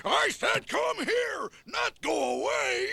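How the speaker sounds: annoyed shout. Acted. Weasel scolds the crickets, annoyed shout. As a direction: yell after the fleeing crickets.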